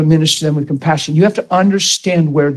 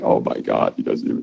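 whispered, hushed dismay; a whispered imitation of an anxious caller; mock whispered panic; 7 dB quieter than usual. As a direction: breathe it out like a hurt caller. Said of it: "they're like".

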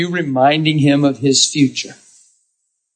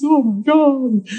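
slow amazed gasp; 8 semitones higher, slower than usual. Retold or imitated. Woman amazed again.

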